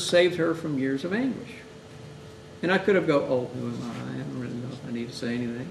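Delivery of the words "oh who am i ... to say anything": soft, quick, unsure; hesitant self-doubt. Retold or imitated. Timid chaplain talking himself out of speaking, quiet doubt. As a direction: mumble it away, doubting.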